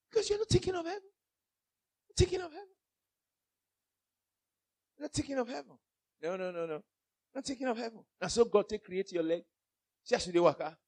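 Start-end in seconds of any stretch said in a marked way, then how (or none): 0.1-1.0 tiny, very high, soft voice; high mimicking voice. Preacher mimics those not thinking of heaven, high soft voice. 2.2-8.0 small, soft, high-pitched mimicry; high mimicking voice. Preacher mimics people heedless of heaven, soft high voice.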